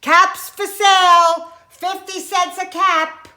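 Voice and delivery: loud, high street seller's cry; peddler's ringing sales call; calling out wares. Acted. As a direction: call out to the whole town. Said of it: "he walked back to town calling".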